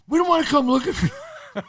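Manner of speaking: a high, loud threat that breaks into laughter; laughing mock threat. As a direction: play the menace, but crack up halfway. Vito's final threat, laughing.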